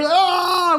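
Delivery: very high excited scream; crowd scream. Retold or imitated. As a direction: shriek like the crowd watching. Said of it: "We were screaming".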